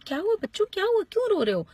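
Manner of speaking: high-pitched questioning parent voice; concerned parent. Acted. Parents come out to crying children, high-pitched concern. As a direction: ask the children, pitched well up.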